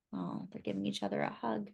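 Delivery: soft, quick aside; tender quiet aside over the page; hushed aside. Real, not acted. Reader aside to child.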